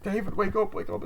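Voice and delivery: high-pitched mock pleading; acted frantic pleading. Friend pleads with dead David, mock frantic pleading. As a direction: beg him awake in pretend panic.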